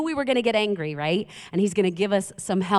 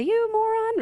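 much higher pretend insult at a driver; mock insult; 14 semitones higher than usual. Preacher acting out yelling at a driver in traffic.

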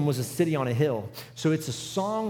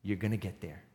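quiet reassurance; 9 semitones lower, 9 dB quieter than usual. Retold or imitated. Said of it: "Second thing he said was".

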